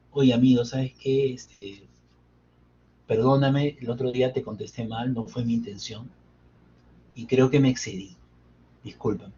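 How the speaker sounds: soft apology. Retold or imitated. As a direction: gently apologize to a friend you hurt. Friend apologizes for rude answer. (disculpa suave y sincera, en voz baja)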